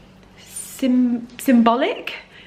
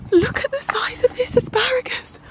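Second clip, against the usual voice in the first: breathless hushed whisper, pitched up; awed whisper. Real, not acted. Showing viewers the giant asparagus, an awed whisper. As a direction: whisper it in disbelief.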